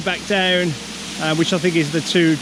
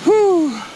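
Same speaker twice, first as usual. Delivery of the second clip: breathless whew; 14 semitones higher, 4 dB louder than usual. Real, not acted. Breath after the climb.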